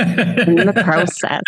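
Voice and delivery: slow Canadian "process" with a long pro vowel; Canadian accent. Shown as a demonstration. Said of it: "Certain words, it comes out".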